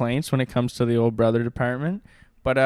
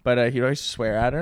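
ordinary delivery

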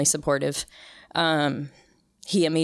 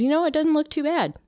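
imitating the husband, much higher, gentle and reassuring; husband's reassurance; 12 semitones higher than usual. Husband consoles her after shaving.

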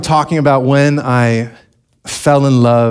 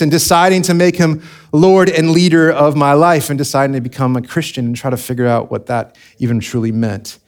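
usual speaking voice

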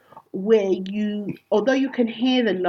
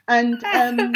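ordinary delivery

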